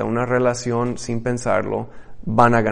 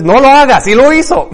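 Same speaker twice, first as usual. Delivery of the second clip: high loud exclaim; 13 semitones higher, 10 dB louder than usual. Acted. Preacher to congregation, mock exclaimed warning, high and loud. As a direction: shoot way up, almost crying out.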